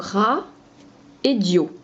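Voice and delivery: ra, puis dio, bien séparés; découpe radio en deux syllabes; syllabes détachées. Shown as a demonstration. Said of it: "On peut les séparer". (syllabes bien détachées)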